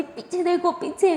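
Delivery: urgent warning. A narrator's reading. Warning Durga of attacker.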